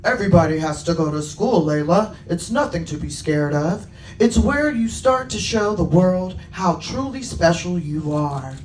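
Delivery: lightly voiced reassuring guardian; Trevin, kindly troll guardian. Troll reassures little unicorn at bedtime, lightly voiced encouragement. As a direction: encourage a nervous little one. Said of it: "Trevin said".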